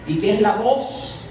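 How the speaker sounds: ordinary delivery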